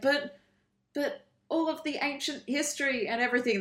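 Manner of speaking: high, halting objection; mock pleading protest. Acted protest against the villain.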